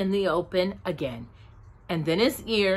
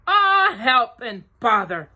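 a touch of bear distress; stuck Pooh; 3 semitones higher, 4 dB louder than usual. A narrator's reading.

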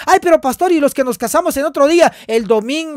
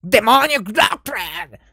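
mocking echo; 4 semitones lower, much slower than usual. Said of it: "Dice que es una doctrina dem".

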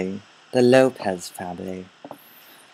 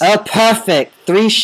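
excited; 10 semitones higher, 7 dB louder than usual. Real, not acted. Spotting sheep in game.

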